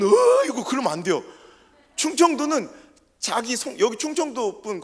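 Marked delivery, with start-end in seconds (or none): 0.0-1.2 Chungcheong reluctance. Retold or imitated. Preacher mimicking a Chungcheong person politely declining. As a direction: play a hesitant Chungcheong local.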